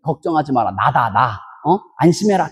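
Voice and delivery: low, soft, reassuring voice; hushed, low-pitched reassurance; 예수님. Retold or imitated. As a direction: drop deep and quiet to calm the frightened. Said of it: "예수님께서".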